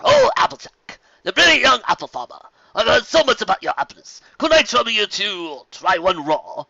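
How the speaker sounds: high, loud, courtly welcome; gracious nobleman. A narrator's reading. Fancy Pants greeting the contestants, loud and courtly. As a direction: address the crowd like a genteel aristocrat.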